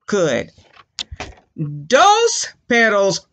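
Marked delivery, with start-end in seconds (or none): none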